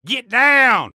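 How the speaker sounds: ordinary talk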